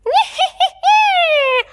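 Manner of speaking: high, loud cackling witch laugh; witch cackle. Shown as a demonstration. Coach joins students in a high witch cackle. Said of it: "then we'll do the witch cackle".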